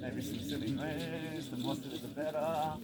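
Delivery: high, loud and silly; playful and loud. Real, not acted. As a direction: whoop it up with the group. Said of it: "we could laugh, make some silly sound".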